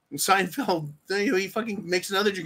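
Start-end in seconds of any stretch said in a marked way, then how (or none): none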